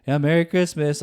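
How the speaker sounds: acted-out bleary early-morning cheer; exhausted parent. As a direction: groan out a mock-weary holiday greeting. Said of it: "being, like, exhausted".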